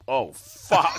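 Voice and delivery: loud, breathy burst of alarm; mock startled awakening. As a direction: ham up waking in shock. Imitates a startled woken sleepwalker.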